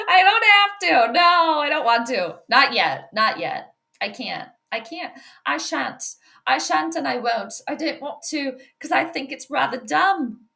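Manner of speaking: playful put-on British accent, prim and refusing; mock-posh British refusal, light and teasing. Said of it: "I'm talking in a British accent".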